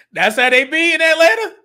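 loud, high, amused outburst; laughing reaction to a ridiculous clip. Real, not acted. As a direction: crack up as you say it. Cracks up at the played clip.

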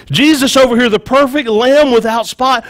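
ordinary talk, nothing marked

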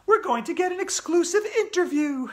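very high, loud schoolgirl announcer; Andrea, keen reporter. Acted. Andrea promising viewers an interview, excited reporter. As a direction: trumpet the scoop up high.

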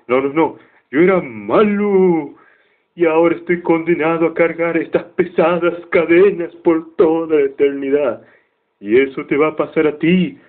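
voz espectral aguda y lenta; fantasma lamentoso. Retold or imitated. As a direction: advierte a Scrooge desde el más allá. Fantasma de Marley advierte. (Marley advierte a su socio con voz de fantasma)

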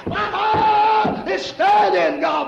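loud, high, slow climactic shouting; preaching climax. Acted. Preacher cries out to the church at the climax, full force. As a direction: let it ring out as the peak of the sermon.

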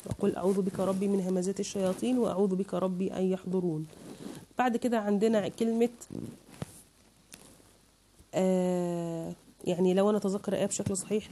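normal talking voice

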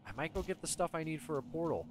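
normal talking voice

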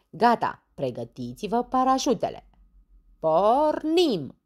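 loud, high countdown to a jump; rally them, building to a cry; shouted command. Acted. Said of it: "strigă el". (comandă strigată înainte de salt)

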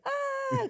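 high, loud mock scream of fear; hammed-up terrified yelp; imitated frightened scream. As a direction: scream like a scared younger kid. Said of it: "he was afraid of me".

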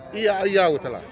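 usual speaking voice

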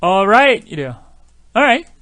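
Alright with no L sound, "a-right". Shown as a demonstration. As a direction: drop the L in Alright completely. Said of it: "으 이렇게 없어요".

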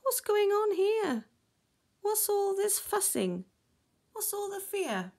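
high-pitched storybook squid voice; high, questioning character voice; inquiring squid. A narrator's reading. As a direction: ask it high, puzzled by the fuss. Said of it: "said a squid".

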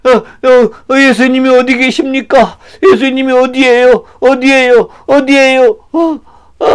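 high, urgent blind man; anxious searching. Acted. Blind man groping for Jesus, anxiously searching. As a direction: call around helplessly, pitched high.

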